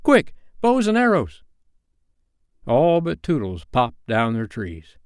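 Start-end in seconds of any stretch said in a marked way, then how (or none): 0.0-1.4 high, excited call to arms; urgent cry. A narrator's reading. Boys rushing for their weapons, high urgent cry. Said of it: "cried the simple boys".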